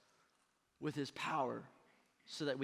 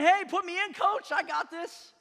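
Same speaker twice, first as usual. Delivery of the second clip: high, loud plea to be put in; eager player's shout; 14 semitones higher, 10 dB louder than usual. Acted. Player begging the coach to be put in, eager shout. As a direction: call out to the coach, raring to go. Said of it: "almost kinda like saying".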